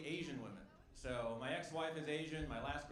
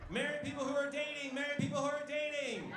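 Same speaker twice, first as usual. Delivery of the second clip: higher, louder teasing call; 9 semitones higher, 6 dB louder than usual. Real, not acted. Comic calls out cheaters in the crowd, loud and teasing. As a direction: needle the cheaters in the room. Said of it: "calls to audience".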